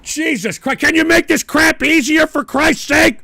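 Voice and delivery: loud, high-pitched furious complaint; real outburst of anger; exasperated shouting. Real, not acted. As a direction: yell in frustration at the owner. Rages at the site's owner.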